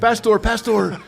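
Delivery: high-pitched imitated Filipino accent. Inmates urging the pastor on, imitated Filipino accent. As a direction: do eager callers in a Filipino accent. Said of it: "That's my Filipino accent".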